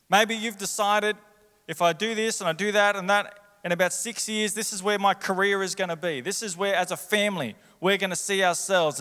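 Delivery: impassioned preaching. Real, not acted. Preacher voices the congregation's plans for a house, impassioned preaching.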